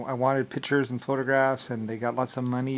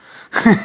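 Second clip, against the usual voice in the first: loud laugh; 9 semitones higher, 15 dB louder than usual. Real, not acted. Laughs at own joke.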